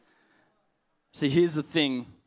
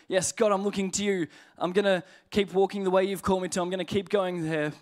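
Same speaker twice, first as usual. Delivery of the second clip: higher-pitched keen promise to God; eager resolve; 6 semitones higher than usual. Acted. Believer telling God they will keep walking, eager and resolute. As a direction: pledge it upbeat, before the doubt hits. Acted eager prayer before doubt sets in.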